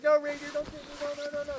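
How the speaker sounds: high, timid begging voice; weak pleading. Shown as a demonstration. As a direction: play the scared, pleading visitor. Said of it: "If you do this one, you're like".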